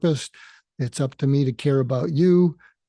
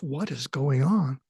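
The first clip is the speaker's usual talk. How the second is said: softer, slow, as if thinking aloud; puzzled inner voice; 5 dB quieter, slower than usual. Retold or imitated. Thinking to himself after a surprising remark, quietly puzzled. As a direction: wonder it quietly to yourself.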